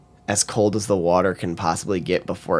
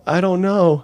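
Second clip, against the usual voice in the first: lightly imitated awkward person, higher pitched; mock an awkward dodge in a raised voice; socially awkward; 10 semitones higher, 4 dB louder than usual. Imitating an awkward shopper avoiding controversy, socially awkward. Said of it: "socially awkward would just probably be like".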